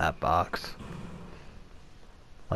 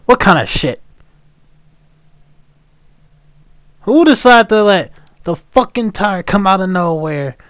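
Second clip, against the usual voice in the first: loud, high ranting shout; angry shout; 10 semitones higher, 14 dB louder than usual. Real, not acted. Player killed out of nowhere, shouting angrily at the game. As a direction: rage at the unfair death.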